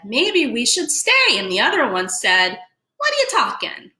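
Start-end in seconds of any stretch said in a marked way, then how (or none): 0.0-1.4 timid little fish. Acted. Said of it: "One of them said". 2.9-3.9 put-on New York accent, much higher and pushy; a squeaky New Yorker fish scoffing. Little fish scoffs at brother in New York accent. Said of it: "a New York way of saying".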